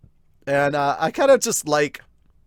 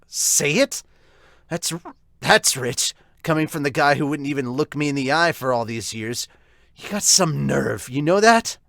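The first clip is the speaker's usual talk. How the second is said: louder, sneering comeback to his father; bitter retort; 4 dB louder than usual. Acted. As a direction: throw his words back in scorn. Brother angrily confronts his father.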